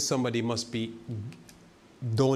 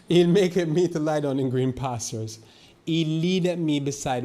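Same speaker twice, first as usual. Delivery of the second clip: slow, higher and louder, swelling into the verse; louder scripture proclamation; 4 semitones higher, 6 dB louder than usual. Shown as a demonstration. Preacher rereads Psalm 23 to his flock, louder and higher. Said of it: "It sounds so loud".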